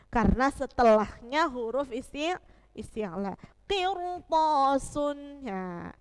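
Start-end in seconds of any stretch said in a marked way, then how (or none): 3.7-5.4 Kirtosun very slow, ro read thick; heavy ro. Shown as a demonstration. Teacher confirms the answer with a thick ro. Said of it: "bacanya te-, tebal".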